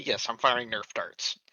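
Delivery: ordinary talk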